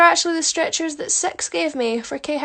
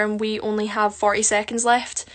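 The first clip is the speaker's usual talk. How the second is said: normal talking voice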